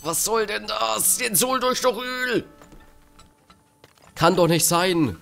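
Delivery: high, exasperated shouting at the screen; angry outburst. Real, not acted. Frustrated at buyers in game. (genervter Ausbruch)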